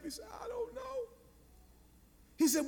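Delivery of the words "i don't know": tiny, high, very soft whimper; mock child's helpless whine; imitated crying. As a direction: mimic a tearful little boy. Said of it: "And he's crying".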